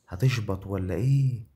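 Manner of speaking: rising yell of anticipation; loud excited yell. Real, not acted. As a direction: shout the question at the screen. Repeats louder, drawn out.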